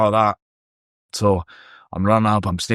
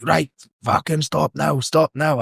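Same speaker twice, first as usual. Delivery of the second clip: raised, higher-pitched order to stop, slowed down; retold shout; 6 semitones higher, slower than usual. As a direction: bark it after him as he runs. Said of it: "It's loud 'cause I'm shouting".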